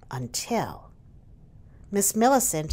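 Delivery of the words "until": whisper. A narrator's reading. Suspense pause before twist.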